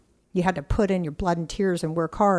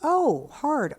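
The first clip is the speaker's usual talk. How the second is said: universe echoing back; 8 semitones higher than usual. Retold or imitated. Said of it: "the universe says".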